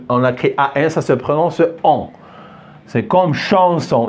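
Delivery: ordinary talk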